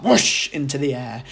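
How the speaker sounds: ordinary delivery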